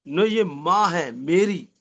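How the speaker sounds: low, slow delivery of the ad's last line; a hint of a TV ad voice, low and slow; ad punchline. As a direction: drop the voice and slow right down. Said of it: "एक dialogue मारा last में".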